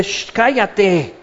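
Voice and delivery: slow, drawn-out mock shushing; hushing. The crowd silences Bartimeo along the road, hushing him. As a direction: shush someone like an annoyed bystander.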